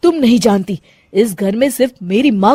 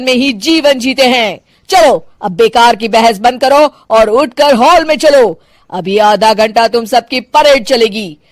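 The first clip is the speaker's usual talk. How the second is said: higher, commanding mother-in-law voice; strict retired cop scolding her daughter-in-law; stern policewoman mother-in-law; 4 semitones higher, 7 dB louder than usual. Acted. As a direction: lay down the house rules like an officer.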